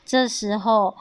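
ordinary delivery, nothing marked

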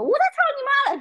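furious shout, pitched high and loud; loud yell. Retold or imitated. Cursing back at the rude driver on the phone, loud yell. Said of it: "超大声说".

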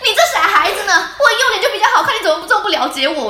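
high, loud hammed-up teacher voice, laughing; mock vain teacher. Mocking the teacher who wanted his good side, hammed-up.

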